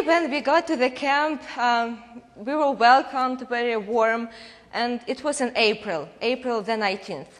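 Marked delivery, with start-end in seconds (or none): none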